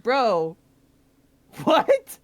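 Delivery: retold disbelief. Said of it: "I was like".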